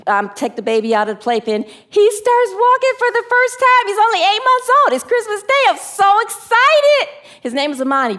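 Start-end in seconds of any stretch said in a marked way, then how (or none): 2.0-7.0 excited. Retold or imitated. Reliving joyful Christmas moment.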